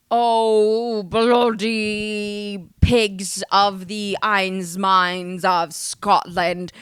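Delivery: drawn-out mock British accent. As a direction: stretch it out in a put-on British accent. Mock British pub name.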